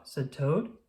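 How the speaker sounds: ordinary delivery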